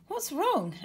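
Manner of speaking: high, sudden concern; concerned question. Acted. Asher to the upset waiter, concerned. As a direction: ask in quick alarm. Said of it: "Asher asked".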